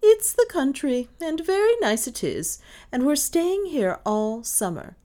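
high, emphatic declaration; decisive. A narrator's reading. Mistress announcing they stay all summer, decisive. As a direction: lay down the law cheerfully firm. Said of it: "said Miss Cornelia decisively".

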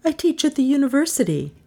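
high, fast character voice; Melissa's voice. A narrator's reading. Melissa explaining her job, high quick voice. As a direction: get it out quickly, pitched high.

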